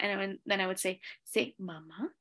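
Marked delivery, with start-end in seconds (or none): 1.2-2.1 soft prompt, rising at the end; calm coaching voice. Retold or imitated. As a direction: model it softly for a baby to copy. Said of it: "You need to have a calm voice".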